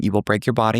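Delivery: usual speaking voice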